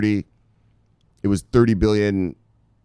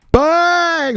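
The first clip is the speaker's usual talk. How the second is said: long, high, loud BANG; shouted catchphrase; 6 dB louder than usual. Real, not acted. Host firing up viewers at the show's start, shouted. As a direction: holler it high and drawn out.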